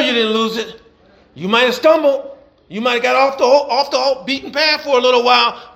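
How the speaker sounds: rhythmic preacher cadence, building; preaching. Acted. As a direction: preach it to the congregation with fire. Preaching to congregation.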